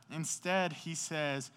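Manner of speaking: normal talking voice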